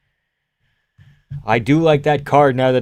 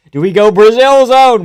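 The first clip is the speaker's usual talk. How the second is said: loud mock; 7 semitones higher, 9 dB louder than usual. Mocking announcer's hometown call.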